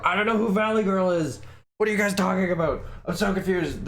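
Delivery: high-pitched, bewildered. Acted. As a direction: squeak out the confusion. High confused voice.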